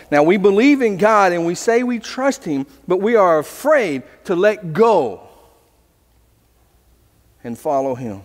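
ordinary talk

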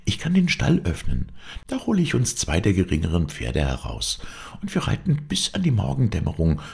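put-on high-pitched, eager young woman; eager heroine. Heroine planning night flight with her lover, eager. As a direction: lay out the escape plan in a lifted girlish voice.

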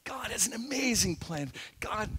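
soft stage whisper. Shown as a demonstration. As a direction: say it softly, like a secret to a child. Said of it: "Just whisper".

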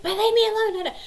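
very high, loud whiny plea; imitating upset daughter. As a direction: wail it like a fed-up girl, high and loud. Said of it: "And she was like".

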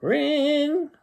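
high, held ringing note; imitated phone ring. A narrator's reading. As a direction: sing out like a telephone bell.

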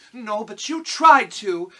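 put-on loud, high, slow girl's scolding; sharp retort. A narrator's reading. Dorothy retorts to the lion, loud indignant girl's voice. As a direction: throw it back loudly, pitched high. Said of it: "she retorted".

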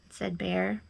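ordinary delivery

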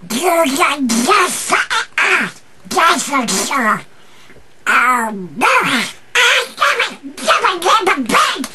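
high squawky cartoon duck; Donald Duck voice. Shown as a demonstration. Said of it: "another reel of Donald Duck".

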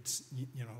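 usual speaking voice

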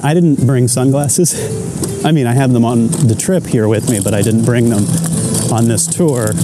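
normal talking voice

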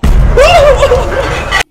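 very high, loud shriek of laughter; high cackling laugh. Real, not acted. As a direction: cackle as you get dragged off. Demons pulling him to hell.

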